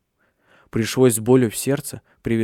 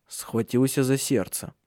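ordinary delivery, nothing marked

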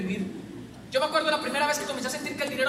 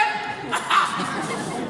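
big laugh. Real, not acted. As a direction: cackle at your own story. Laughs at own retold scene. (carcajada fuerte)

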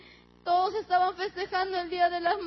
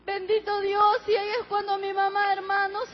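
usual speaking voice

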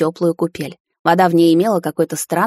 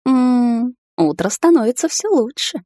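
higher, dreamy purring tone; 6 semitones higher, slower than usual. A narrator's reading. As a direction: purr it like a pleased cat. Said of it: "мечтательно промурчала я". (мечтательно мурлыча)

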